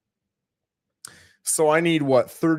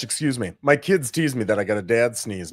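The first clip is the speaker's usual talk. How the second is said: ordinary talk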